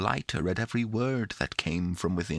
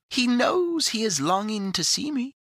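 high, playful, coaxing creature; laughing little voice. A narrator's reading. The creature in the box coaxes Pandora to lift the lid, playfully. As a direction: wheedle merrily in a tiny high voice. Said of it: "cried the little voice within the box in a laughing tone".

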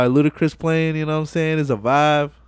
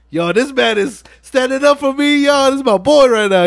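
hyped-up Brian; 10 semitones higher, 5 dB louder than usual. Retold or imitated. Brian hyped about Dom.

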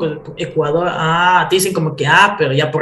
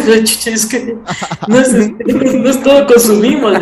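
higher, protesting through laughter; laughing retort; 5 semitones higher, 4 dB louder than usual. Retold or imitated. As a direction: retell your own protest, breaking up laughing. Cuenta entre risas su réplica. (réplica entre risas)

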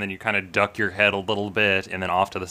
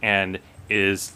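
ordinary talk

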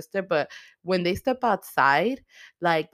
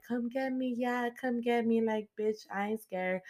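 mock tough taunt; 6 semitones higher, 6 dB quieter than usual. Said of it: "being all big and bad".

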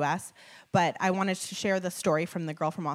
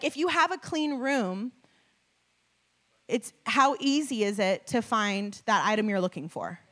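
ordinary talk

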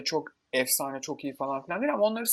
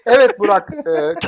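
loud, higher, theatrical tease; dramatic announcement; 6 semitones higher, 12 dB louder than usual. Pretend. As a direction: announce it like a showman. Mock dramatic topic announcement. (abartılı sunucu sesi)

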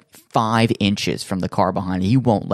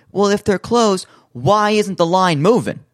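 high, louder, put-on puzzled voice; mock baffled voice; 11 semitones higher, 4 dB louder than usual. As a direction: play up the confusion in a high, louder voice. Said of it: "So then we go".

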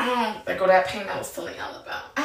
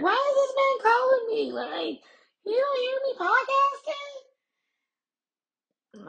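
much higher, incredulous mock complaint; exasperated surprise. Wife baffled her man calls during podcast, much higher. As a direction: sound baffled he's calling. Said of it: "And I'm like".

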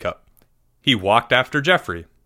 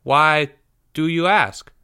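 higher-pitched, innocently curious question; innocent curiosity; 3 semitones higher, slower than usual. A narrator's reading. Pupil questioning his teacher, innocently curious. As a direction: ask with wide-eyed innocence. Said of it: "continued with what might have been innocent curiosity".

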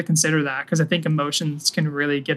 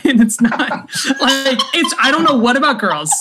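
laughing; 10 semitones higher, 6 dB louder than usual. Real, not acted. Laughing at own confession.

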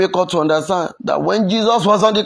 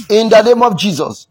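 louder prayer punch; forceful declaration; 5 semitones higher, 7 dB louder than usual. Real, not acted. Preacher sealing a prayer over mockery, forceful declaration.

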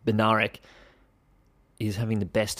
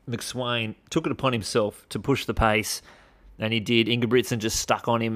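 ordinary talk, nothing marked

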